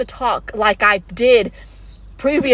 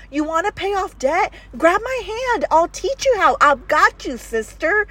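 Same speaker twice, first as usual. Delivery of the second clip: eager, higher sisterly pep talk; 6 semitones higher than usual. Retold or imitated. Calling a sister to follow, higher and eager. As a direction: pitch it higher and reach out, cheering her on. Voicing her mission to women.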